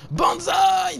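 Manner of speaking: high, loud war cry; battle cry. Real, not acted. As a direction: charge in with a shout.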